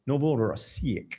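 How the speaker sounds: slow, soft Novorossiysk said the Russian way, rolled and drawn out; Russian accent. Shown as a demonstration. Host naming a Russian port, in Russian accent. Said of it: "You gotta do that Russian accent on it".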